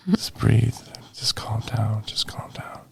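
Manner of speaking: soft breathy whisper to himself; whispered self-soothing, fading away. Retold or imitated. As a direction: talk yourself down in a hush. Calming himself during breathing panic.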